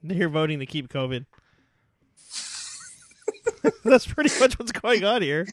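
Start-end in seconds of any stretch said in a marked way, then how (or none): 3.3-3.8 high, quick giggling laugh; burst of laughter. Real, not acted. Host cracking up at co-host's covid joke, laughing. 3.8-5.5 higher-pitched, through real laughter; laughing. Real, not acted. Host agreeing with co-host's covid joke, laughing.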